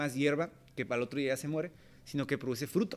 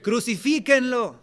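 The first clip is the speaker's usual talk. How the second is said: higher, louder, drawn-out yell; crowd yell; 7 semitones higher, 6 dB louder, slower than usual. Retold or imitated. The crowd demanding Jesus die, slow angry yell. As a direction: shout it like an angry mob. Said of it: "gente que estaba gritando".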